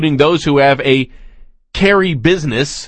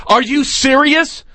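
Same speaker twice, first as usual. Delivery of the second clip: incredulous; 11 semitones higher, slower than usual. Real, not acted. Host mocking guest.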